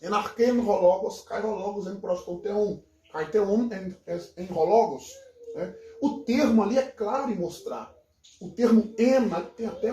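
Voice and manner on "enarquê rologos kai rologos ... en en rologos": "Enarquê" with hard k, "rologos" with breathy-onset article; reconstructed Attic recitation. Shown as a demonstration. Professor reciting John's opening in Attic, "Enarquê rologos". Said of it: "Eu vou falar a pronúncia, é, ática reconstituída".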